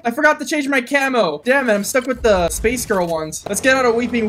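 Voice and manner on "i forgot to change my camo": usual speaking voice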